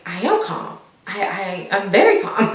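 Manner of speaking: soft, measured mock composure; hushed imitation of someone insisting they are calm. Accused friend answers that she is calm, quietly composed. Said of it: "the whole time she was very calm".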